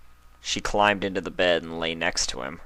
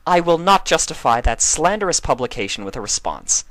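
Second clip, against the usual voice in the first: higher, louder indignant huff; 9 semitones higher, 7 dB louder than usual. Acted. Celestia refusing to answer Luna's jab, huffy. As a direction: bristle with offended dignity. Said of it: "Celestia said in a huff".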